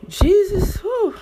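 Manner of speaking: high, loud exasperated exclamation. Real, not acted. Recalling the fight, exasperated high outburst. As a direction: blow off steam in disbelief.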